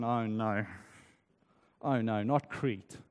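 low, sinking groan of dread; mock dismay. People of that time hearing of Crete, in mock low dismay. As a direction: act dismayed, dropping well down in pitch.

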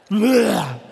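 loud mock retch into the regulator; retching sound. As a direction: spit it out like throwing up underwater. Imitating vomiting underwater.

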